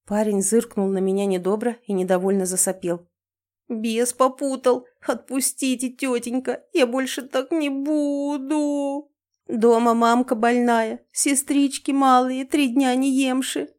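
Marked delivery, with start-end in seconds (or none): 3.7-13.7 high, slow, drawn-out begging wail; pitiful whimpering plea. Acted. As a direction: whimper to win pity. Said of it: "включил режим «разжалобить» и начал горестно подвывать".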